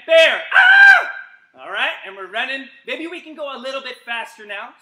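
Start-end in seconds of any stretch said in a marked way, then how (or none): 0.1-0.5 loud shout of the warning; playful warning yell; shouted command. Acted. Calling out commands in game. 0.5-1.1 very high, loud mock scream; hammed-up fright shriek. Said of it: "jump away ... and go".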